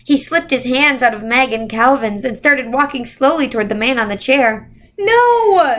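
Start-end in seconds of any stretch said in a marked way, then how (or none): 5.0-5.8 high loud desperate scream. A narrator's reading. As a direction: scream no with all her might. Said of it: "Meg screamed".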